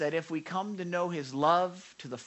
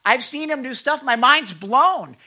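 much higher, loud, gasping awe; amazed; 9 semitones higher, 11 dB louder than usual. Retold or imitated. The disciples learning who Jesus is, high and loud. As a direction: blown away by him. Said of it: "The disciples are learning".

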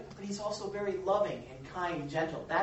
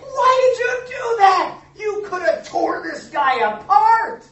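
much higher and louder, slow indignant protest; mad friend; 15 dB louder, slower than usual. Retold or imitated. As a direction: scold him for backing down. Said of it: "his friend got so mad at him".

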